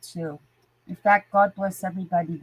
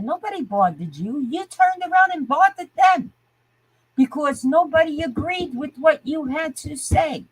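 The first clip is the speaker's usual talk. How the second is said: high-pitched, indignant rant; upset; 7 semitones higher than usual. Real, not acted. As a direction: argue back hotly, pitch swooping up. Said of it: "I'm just upset because I am tired".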